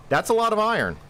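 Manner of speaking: high delighted exclaim; thrilled at the haul; excited. Real, not acted.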